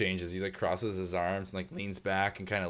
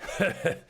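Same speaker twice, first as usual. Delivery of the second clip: mocking laugh; 11 semitones higher, 3 dB louder than usual. Husband laughs at player.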